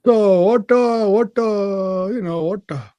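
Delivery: exasperated boss in a put-on Indian accent; imitated Indian accent. Boss demanding if Othman is sane, imitated Indian accent. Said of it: "he's like".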